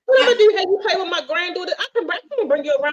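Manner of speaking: high, fast mocking tease. Mocking the short date as a playmate, high and teasing. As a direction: poke fun at his size. Mocking his height, acting.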